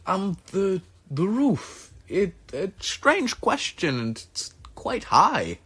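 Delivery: put-on high, eager old craftsman; Ajax's voice. Ajax telling the player his plan, high and eager. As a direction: go high and excited about the roof. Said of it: "He goes".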